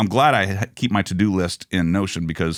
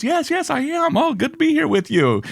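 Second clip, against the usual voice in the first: a higher, lightly played gracious celebrity; breezy, gracious star answering a fan; Tony Randall, lightly voiced. Tony Randall answering the contestant, lightly voiced gracious reply. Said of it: "He goes".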